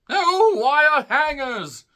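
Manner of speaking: slow shrill-free high scream, dragged out; hammy mother-from-hell screech. Retold or imitated. Mariah slapping mother, Mommie Dearest quote.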